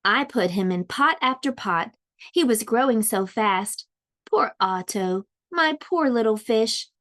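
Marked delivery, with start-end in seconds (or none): none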